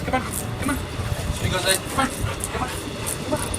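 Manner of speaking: high, soft, half-whispered coaxing; coaxing dog. Real, not acted.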